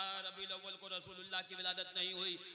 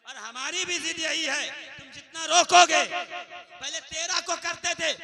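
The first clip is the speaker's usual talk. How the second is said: loud, high, defiant preacher cry; preaching at full force; 8 semitones higher, 20 dB louder than usual. Acted. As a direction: build to a ringing climax. Preacher climbs to climax.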